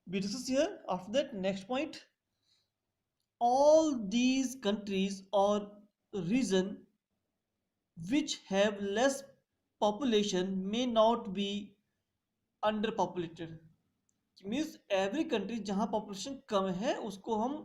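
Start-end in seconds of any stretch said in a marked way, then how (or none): 3.4-13.6 each phrase spaced out for note-taking; slow dictation. Shown as a demonstration. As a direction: dictate it word by word so students can write. Teacher dictates a point slowly. (धीमे-धीमे लिखवाना)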